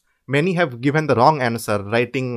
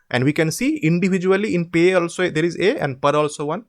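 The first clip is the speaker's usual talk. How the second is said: ordinary delivery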